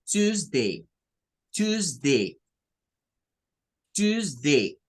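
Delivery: "Tuesday" as tuz-dei; Tuesday modeled. Shown as a demonstration. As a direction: repeat "Tuesday" clearly as tuzdei. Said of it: "Con un sonido de Z".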